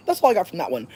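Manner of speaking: ordinary talk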